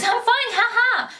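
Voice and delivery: high "I'm fine" with a fake little laugh; mock forced laugh. Vlogger mocking a friend who brushes it off, with a fake little laugh. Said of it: "All you're saying is".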